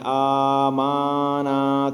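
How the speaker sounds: آ مانا with the ما wrongly stretched long; wrong reading. Shown as a demonstration. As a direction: show the common mistake of lengthening ما. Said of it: "کچھ لوگ پڑھتے ہیں". (غلط طریقے سے لمبا کر کے)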